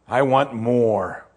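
a hint of a greedy rich man, drawn out slowly. Preacher voicing the greedy man, lightly drawn out. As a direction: stretch out the wanting.

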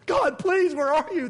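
hammed-up cry for help; mock plea. Preacher joking about feeling alone when teaching, mock plea.

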